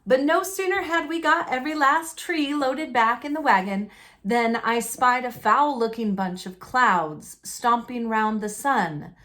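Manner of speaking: ordinary delivery, nothing marked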